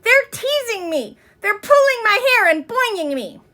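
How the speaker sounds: high, distressed tattling; a teased girl complaining to her teacher; upset complaint. A narrator's reading. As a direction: whine it to the teacher.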